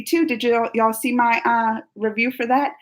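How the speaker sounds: usual speaking voice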